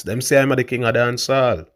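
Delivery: normal talking voice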